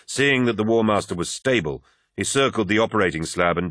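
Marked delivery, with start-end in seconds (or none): none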